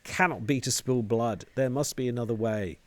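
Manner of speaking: high, loud cry of protest; angry protest. Acted. Woman arguing against bloodshed, angry and loud. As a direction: shout it out, fist on the wall.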